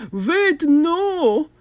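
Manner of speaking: high, loud desperate cry. Acted. Doctor Brock tries to stop the reducer, loud cry. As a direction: shout to stop him. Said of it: "cried Doctor Brock".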